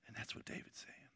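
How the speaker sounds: whisper. Real, not acted. Sudden drop to whisper.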